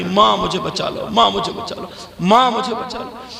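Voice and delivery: restrained, repeated mock plea to mother; understated acted plea; retold cry for help. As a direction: act out a screaming girl without full voice. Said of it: "चिल्लाने लगी".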